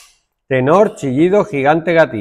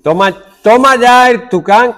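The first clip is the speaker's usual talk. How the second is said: excited boast; 7 semitones higher, 5 dB louder than usual. Real, not acted. Boasting about the translating parrot.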